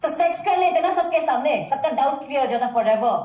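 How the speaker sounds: angry shouting. Acted. Woman to her partner in a quarrel, shouting sarcastically. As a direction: shout it as a bitter jab at a partner. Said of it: "चिल्ला क्यों रही है तू".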